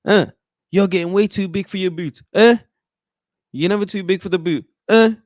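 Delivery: hammed-up manager scolding a player; mock scolding manager. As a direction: tell him off like an annoyed boss. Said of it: "Eric Ten Hag in the back going".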